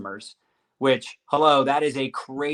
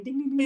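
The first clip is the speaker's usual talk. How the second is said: high, playful ding imitation; slot machine dings; 10 semitones higher, much faster than usual. Host comparing paychecks to slot machine, high playful dings. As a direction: chime like a jackpot slot machine.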